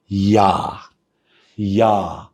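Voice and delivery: normal talking voice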